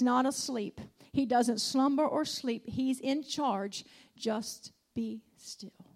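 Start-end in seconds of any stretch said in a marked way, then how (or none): 4.2-5.9 slow hushed whisper. Acted. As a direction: whisper it, almost breathless. Urging stillness in sermon.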